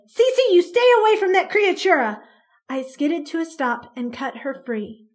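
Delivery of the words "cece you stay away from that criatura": loud, high furious command; mother roaring at her daughter to stay away. Acted. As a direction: roar it as a forbidding order. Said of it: "Mama roared".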